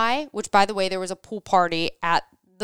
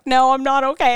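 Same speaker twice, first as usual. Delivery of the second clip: higher, louder mock-tearful protest; retold bawling; 6 semitones higher, 6 dB louder than usual. Telling the pool worker on the phone, retold bawling. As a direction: blurt it out as if about to bawl. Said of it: "I'm, like, bawling".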